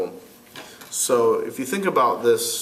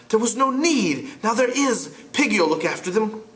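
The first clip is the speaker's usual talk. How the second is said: put-on higher, frustrated boy leader; 10 semitones higher than usual. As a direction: argue as an exasperated boy. Ralph voiced.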